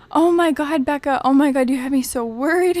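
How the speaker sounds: calling a friend she feared was hurt; relieved outburst. Retold or imitated. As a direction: pour it out in relief.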